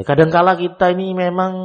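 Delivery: normal talking voice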